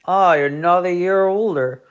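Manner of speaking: a hint of a folksy old colonel greeting the birthday kid; colonel impression. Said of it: "this is my colonel impression".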